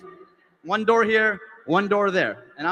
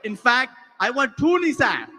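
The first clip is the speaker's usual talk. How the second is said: slow, higher-pitched mock demand; defiant insistence; 4 semitones higher than usual. Customer argues with the security guard, mock-defiant. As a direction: stretch out the stubborn order.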